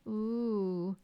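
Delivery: drawn-out, level mock moan; long moan. A narrator's reading. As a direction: moan along in sympathy, half-felt. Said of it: "they all moaned".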